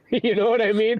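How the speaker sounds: normal talking voice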